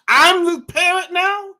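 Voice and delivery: shocked realization. Retold or imitated. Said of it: "I'm like".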